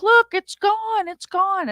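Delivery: mock the clueless boyfriend high and drawn out; imitating a chill surfer's it's gone; boyfriend insisting. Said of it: "and he's like".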